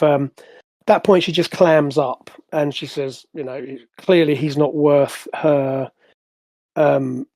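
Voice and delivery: ordinary talk, nothing marked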